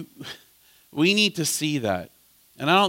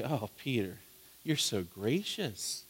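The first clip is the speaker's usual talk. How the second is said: imagined flattery; 5 semitones lower, 13 dB quieter than usual. Retold or imitated. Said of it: "Thinking the Lord's gonna say".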